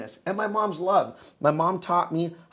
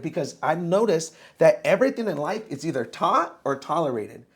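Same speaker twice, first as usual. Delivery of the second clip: normal talking voice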